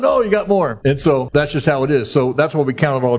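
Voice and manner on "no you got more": coach insisting. Retold or imitated. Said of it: "coach that's kicking your butt".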